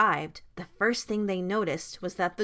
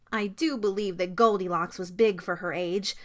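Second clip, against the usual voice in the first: ordinary delivery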